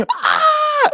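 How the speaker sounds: very high-pitched squeal of a scream; imitated high shriek; mock scream. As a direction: let out a sudden piercing imitation scream. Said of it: "Alexa Bliss screaming at Eva Marie".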